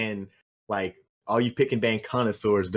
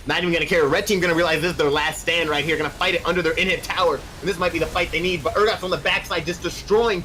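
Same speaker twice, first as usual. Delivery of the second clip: higher-pitched, excited esports commentary; live play-by-play; 7 semitones higher than usual. Real, not acted. Caster calls the game's final fight to viewers, excited play-by-play. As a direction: call the deciding team fight with rising urgency.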